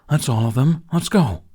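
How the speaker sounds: loud, rising let's go; urging everyone to hurry; impatient call. Acted. Toothless urging Hiccup to leave, loud and impatient.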